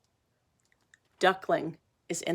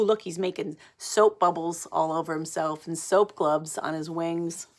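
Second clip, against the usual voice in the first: plain reading voice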